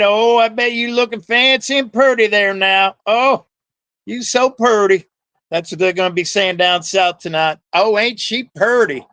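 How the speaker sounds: high mock Southern accent, fawning; teasing Southern praise; put-on Southern accent. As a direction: compliment her in a sugary Southern accent. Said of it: "enough Southern accents here".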